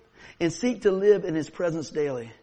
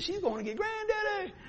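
high answer; 13 semitones higher, 5 dB quieter than usual. Retold or imitated. Telling dad where dog goes.

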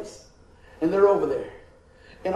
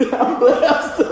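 higher and louder, laughing on I'm blessed; gleeful repeat; 6 semitones higher, 7 dB louder than usual. Retold or imitated. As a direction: relish the line as you repeat it. Repeats the answer with delight.

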